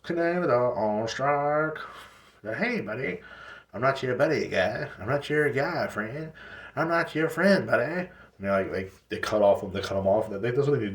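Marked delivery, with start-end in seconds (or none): 0.0-8.1 high, slow mock Canadian squabble; a pitched-up cartoon Canadian arguing buddy guy friend; South Park Canadian. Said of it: "this is like a South Park episode".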